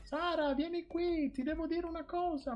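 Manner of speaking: high mock mother yelling for Sara; mother calling out. Son imitating his mother calling out for Sara. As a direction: ham up mom hollering across the house. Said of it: "Tanto mia mamma urla".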